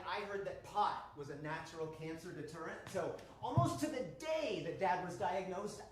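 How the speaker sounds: ordinary delivery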